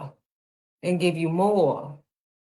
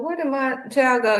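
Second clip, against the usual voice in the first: panicked mother; 7 semitones higher, 3 dB louder than usual. Retold or imitated. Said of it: "I'm like".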